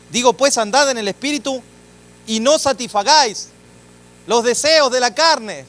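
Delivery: fervent scripture reading; scripture proclaimed. Acted. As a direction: thunder the Bible verse over the congregation. Reads Galatians 5:16 aloud.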